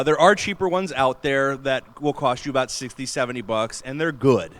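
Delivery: ordinary delivery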